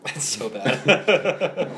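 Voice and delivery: slow, drawn out through laughter; laughing. Real, not acted. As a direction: laugh it out slowly. Laughing at the intro.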